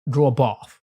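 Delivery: "bath" with a long English "aw" vowel; English accent, round out the vowel in "bath"; put-on English accent. Shown as a demonstration. Streamer trying the other way to say it, English accent. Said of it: "That's how I would say it".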